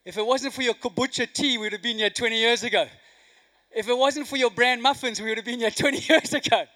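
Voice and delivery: hammed-up husband blaming his wife, rising to a laugh; mock exasperated rant. Husband's rant to wife, joke punchline.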